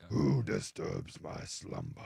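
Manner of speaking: slow deep booming cave voice; grinding stone statue. Retold or imitated. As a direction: rumble it like a giant stone head. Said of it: "the movie Aladdin with Robin Williams".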